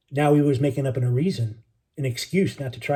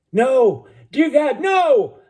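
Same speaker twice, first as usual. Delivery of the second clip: high, loud, drawn-out desperate cry; anguished cry; 11 semitones higher, 6 dB louder, much slower than usual. Acted. Sailor in the water cries out in terror, high and loud. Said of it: "a single voice cried out".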